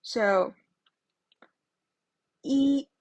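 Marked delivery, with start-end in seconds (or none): 2.4-2.9 一 said yī, high and level; teacher modelling 一 loudly; flat first tone yi. Shown as a demonstration. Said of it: "that is an ordinal number".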